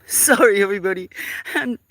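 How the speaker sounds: laughing apology. Real, not acted.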